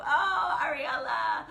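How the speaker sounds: high, loud burst of delight; gushing shout-out to a viewer; delighted exclamation. Real, not acted. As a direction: cry out the name with joy.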